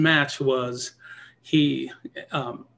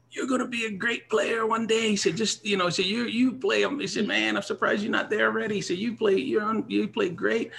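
imitating Earl; 7 semitones higher, 3 dB quieter than usual. Imitating rival Earl's praise.

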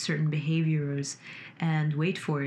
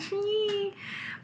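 high laugh; 3 dB quieter than usual. Real, not acted. Laughs after mocking voice.

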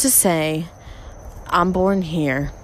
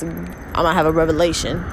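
ordinary delivery

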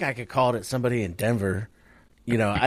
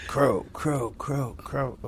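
shrill screeching bird caw; bird call; 5 semitones higher, much slower than usual. Shown as a demonstration. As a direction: caw like a crow. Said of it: "do your caw-caw".

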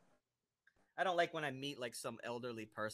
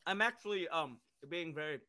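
chewing ice; 5 semitones higher, 5 dB louder than usual. Real, not acted. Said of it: "sorry, I'm chewing ice".

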